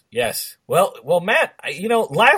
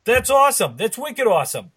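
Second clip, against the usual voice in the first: put-on higher, louder hokey Boston accent; bad Boston accent; 8 semitones higher, 4 dB louder than usual. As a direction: echo it in a big Boston accent. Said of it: "bad Boston accents".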